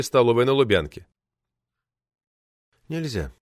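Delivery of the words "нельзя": soft, short refusal; quiet reply. A narrator's reading. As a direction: say it quietly from a far corner. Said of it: "тихо объяснил из своего дальнего угла". (тихо)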